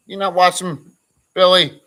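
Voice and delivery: high, loud call of the name. Real, not acted. Streamer calls out a viewer who isn't watching, loud and high. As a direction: call him out, demanding an answer.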